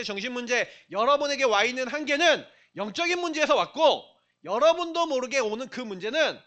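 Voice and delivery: loud, fervent sermon climax; preaching at full force. Acted. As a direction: preach it out to the congregation.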